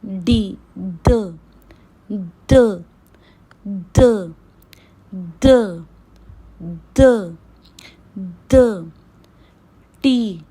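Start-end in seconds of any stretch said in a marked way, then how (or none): 0.8-8.9 द said crisply with no air; teacher model द, no breath; unaspirated de. Shown as a demonstration. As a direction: clean unaspirated द, louder. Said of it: "अब हम सीखेंगे द".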